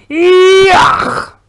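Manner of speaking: huge high roar; giant's roar of disgust. A narrator's reading. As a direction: bellow out in disgust. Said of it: "brulde de bloedbottelaar". (reus brult luid van walging)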